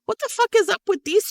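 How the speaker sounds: high-pitched mock outrage; high incredulous quote. Host retells asking the author about the sisters, high and incredulous. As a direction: ask it up high, baffled.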